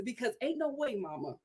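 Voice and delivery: ordinary talk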